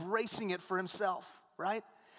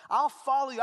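acted believer's vow; 7 semitones higher, 9 dB louder than usual. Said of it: "excuse me".

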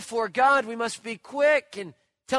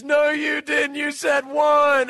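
high, loud, drawn-out protest; arguing kid; 6 semitones higher, 6 dB louder, slower than usual. Retold or imitated. Friend disputing the foul ball promise, loud childish protest. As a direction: mimic a kid yelling an accusation.

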